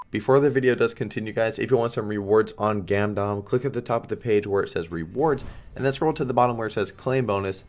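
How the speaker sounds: loud, fast promo voice; sponsor ad read. Acted. Streamer reading his sponsor promo to viewers, fast ad read. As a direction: rattle off the promo code pitch.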